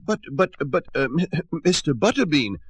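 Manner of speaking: high, stammering squeak; squeaky mayor. Acted. The nervous mayor protesting to Butterbean, high squeak. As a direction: stammer like a timid little mayor. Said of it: "squeaked the mayor".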